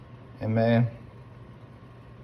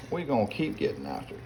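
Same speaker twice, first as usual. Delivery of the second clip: "We're gonna keep getting after it" dropped much softer, an under-the-breath repeat; quiet echo; 2 semitones higher, 8 dB quieter than usual. Real, not acted. A cook repeating it to himself over the pot, softly. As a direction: say it again to yourself, softly.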